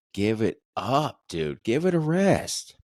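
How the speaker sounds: drawn-out, exasperated plea, leaping high at the end; exasperated telling-off. Retold or imitated. Fed-up customer to begging salesmen, exasperated. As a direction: drag it out, voice swooping up in exasperation.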